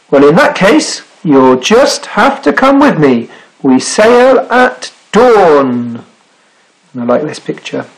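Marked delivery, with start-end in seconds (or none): 0.1-6.0 higher, deliberate, ending very drawn out; grand invitation. A narrator's reading. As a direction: announce the voyage with relish. Sailor announces voyage grandly.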